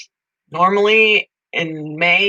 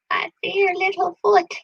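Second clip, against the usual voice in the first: high cooing; 13 semitones higher than usual. Real, not acted. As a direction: coo to a tiny foot. High cooing at a little foot.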